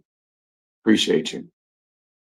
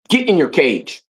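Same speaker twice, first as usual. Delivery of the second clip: sharp, much higher command to the dog; firm command to dog; 8 semitones higher than usual. Real, not acted. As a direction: tell the dog off, high and insistent. Ordering dog Stormy into cage.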